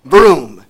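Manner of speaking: loud engine vroom; vroom sound. A narrator's reading. Narrator voicing the elevator blasting off, loud vroom. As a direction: roar it like a rocket launch.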